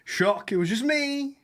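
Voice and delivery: high, slow mock surprise; sarcastic high-voiced shock at the joke. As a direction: pitch way up in fake surprise. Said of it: "third person on the podcast".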